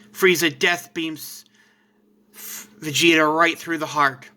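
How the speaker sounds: ordinary delivery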